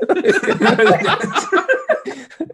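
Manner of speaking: loud high burst of laughter; hearty laugh. Real, not acted. Narrator laughs hard at his brother's nerve.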